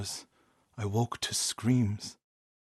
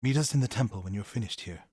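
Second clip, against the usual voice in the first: normal talking voice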